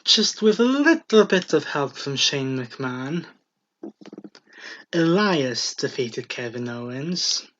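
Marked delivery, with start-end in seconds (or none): none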